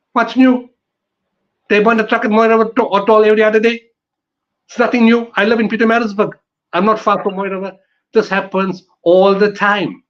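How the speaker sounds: fed up, tossing the rumour aside rapid-fire; scoffing exasperation. Real, not acted. Local resident dismissing panic about truck burnings, exasperated and scoffing.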